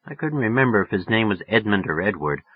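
ordinary delivery, nothing marked

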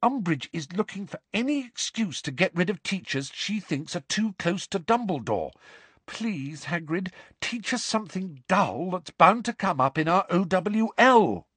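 high-pitched urgent pleading; desperate high-voiced appeal. A narrator's reading. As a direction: implore him to listen. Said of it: "a note of real desperation in her voice".

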